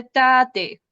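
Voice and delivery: put-on child saying tate; child's attempt. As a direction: imitate a toddler's syllable.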